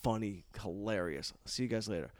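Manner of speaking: low, soft, offhand praise; understated wingman aside; casual drive-by compliment. Retold or imitated. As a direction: toss it off quietly in passing. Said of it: "he would roll up and be like".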